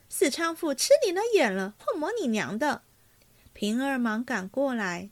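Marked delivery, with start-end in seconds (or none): 0.1-2.8 high, raised-voice mock swearing; indignant but playful outburst; laughing curse. A narrator's reading. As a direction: curse in jest, pitch leaping up. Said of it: "凤姐也禁不住笑骂道".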